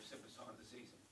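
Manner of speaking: straining through a failing voice; real hoarse, nearly voiceless; losing voice. Real, not acted. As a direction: voice giving out, half whispered. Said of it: "losing my voice".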